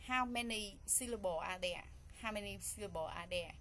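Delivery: ordinary talk, nothing marked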